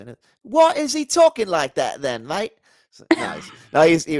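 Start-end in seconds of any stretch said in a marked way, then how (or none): 0.4-2.5 high, loud put-on British accent with a "mate"; mock British accent. Host jokes about the philosopher in a mock British accent. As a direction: tease like a cheeky British bloke. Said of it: "he's British".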